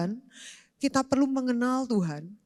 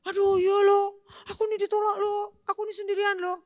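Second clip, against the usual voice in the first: whiny self-pity; 8 semitones higher than usual. Retold or imitated. Mocking self-pity again.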